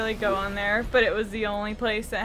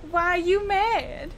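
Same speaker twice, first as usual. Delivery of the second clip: sassy voice; 6 semitones higher, slower than usual. Pretend. Quoting a video line.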